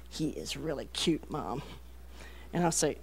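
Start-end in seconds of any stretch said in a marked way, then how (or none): 0.1-1.6 low, very soft, secretive daughter; confiding in mom. Retold or imitated. Daughter leaning over so dad won't notice, hushed confiding. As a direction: imitate a girl sharing a secret under her breath. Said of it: "lean over me ... don't want her dad to see her saying this".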